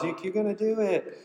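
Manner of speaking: hollering cheer. Retold or imitated. Friend at the finish line hollering encouragement, a high excited call. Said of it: "hooting and hollering up there".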